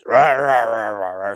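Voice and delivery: mock growling talk in nonsense syllables; mimicking gruff talk. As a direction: growl "rah" over and over, imitating someone. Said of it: "he talks like this".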